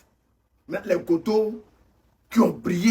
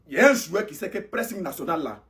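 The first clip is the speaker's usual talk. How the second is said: ordinary delivery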